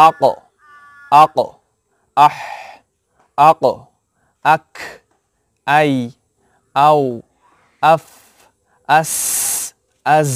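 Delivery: each letter said with a short আ before it and a stopped end: আক, আফ, আজ; letter with hamza. Shown as a demonstration. Teacher to students, drilling letters with hamza. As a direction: clip each letter after a short আ, one by one. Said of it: "হামজা নিয়ে আসব".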